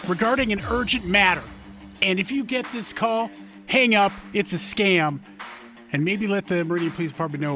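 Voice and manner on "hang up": ordinary talk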